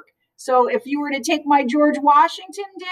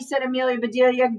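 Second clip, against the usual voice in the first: usual speaking voice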